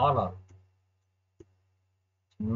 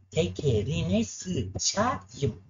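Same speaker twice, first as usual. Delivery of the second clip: drawn-out curse; 4 semitones higher, much slower than usual. Real, not acted. Cursing the taxi again.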